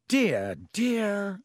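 fussy teacher, rising on the second dear; a flustered showman clucking; Lockhart fussing. A narrator's reading. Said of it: "said Lockhart".

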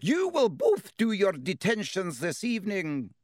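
high, clipped strict professor; stern teacher. A narrator's reading. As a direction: lay down the punishment sternly. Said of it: "looking stern".

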